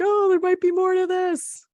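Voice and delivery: mock surprise. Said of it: "You're like".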